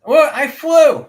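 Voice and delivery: very high, loud squeaky little owl; a tiny owl boasting that he can fly; tiny owl child voice. Acted. GM voicing the little owl bragging to the party, very high.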